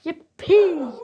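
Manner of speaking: loud, high squawk like a parrot; excited parrot call. Acted. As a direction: cry it out as a happy parrot. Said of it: "parrots because they can go".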